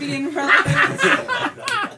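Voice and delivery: high, loud, slow laughing line. Real, not acted. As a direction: laugh through every word. Laughing self-mockery.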